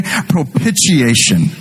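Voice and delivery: "Propitiation" drawn out slowly, each syllable clear; "Propitiation" said slowly for the crowd to learn; slow spelled-out word. Shown as a demonstration. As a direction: stretch "Propitiation" so they can repeat it. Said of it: "Go ahead and say it".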